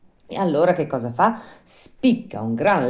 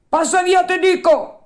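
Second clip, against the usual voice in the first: very high, loud fisherman yell; loud threatening order; angry repeated shout; 9 dB louder than usual. Acted. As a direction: shout the dog away, insistently. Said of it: "gli ripeté il pescatore".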